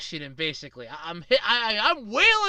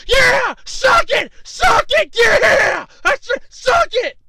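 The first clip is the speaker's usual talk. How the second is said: high, very loud victory screaming; hammed-up triumphant bellowing; gloating shouts; 11 semitones higher, 13 dB louder, slower than usual. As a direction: imitate a sore winner yelling in your face. Acts out winner gloating after throwing controller.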